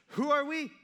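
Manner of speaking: high-pitched, put-on disbelief; mock incredulous. Moses answering grumbling Israelites, mock incredulous. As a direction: ask it high, as if baffled by the blame.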